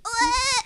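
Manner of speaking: high bleating goat cry; goat impression. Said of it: "Your goat impression".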